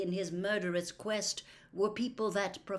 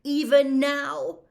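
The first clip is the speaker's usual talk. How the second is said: loud, higher pressing demand; insistent anger; 8 semitones higher, 10 dB louder than usual. Acted. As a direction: push him for an answer. Harry presses Dumbledore angrily.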